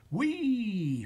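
high, drawn-out falling whee; whee of a drop. A narrator's reading. Spider drops from the shelf, gleeful whee. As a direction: slide down from a high pitch.

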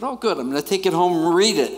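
put-on a boy excited to take the Bible home; eager altar boy. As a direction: blurt it out like a keen kid. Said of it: "I said".

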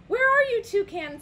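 high, loud searching call. A narrator's reading. Frog looks for missing Toucan, calling loudly.

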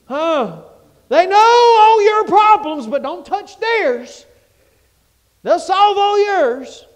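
loud, climbing preacher's call; full-force preaching. Acted. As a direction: preach it hard to the crowd. Preaching, voice rising.

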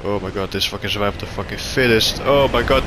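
usual speaking voice

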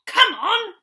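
quick high call to go; eager cry. A narrator's reading. Peter calling the others into the flying chair, eager. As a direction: urge them into the chair, loud and keen. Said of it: "cried Peter".

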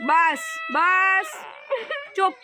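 long drawn-out, soothing hush. Real, not acted. Comforting a weeping elderly woman, drawn-out soothing. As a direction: stretch each word out to calm someone crying.

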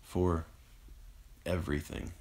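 halting phrases fading to breath; broken, slowing, dying words; barely managed. A narrator's reading. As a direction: eke out each phrase with failing strength. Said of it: "Eric managed".

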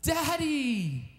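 trapped son calling up to his father; child's shout. Retold or imitated. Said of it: "from inside yell out".

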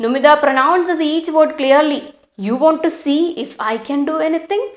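slow, deliberate reading of the story. A narrator's reading. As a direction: read each phrase slowly for the class. Teacher reads story aloud. (പതുക്കെയുള്ള കഥ വായന)

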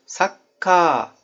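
Sakka with the first A held long, like sakkaa; lengthened vowel. Shown as a demonstration. Teacher showing students the long katakana vowel in Sakka. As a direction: stretch the A in Sakka on purpose. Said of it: "uzatarak söyledim".

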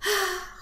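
high exaggerated sigh; falling, theatrical sigh; piglet's sigh. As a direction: let out a put-upon sigh. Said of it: "sighed the piglet".

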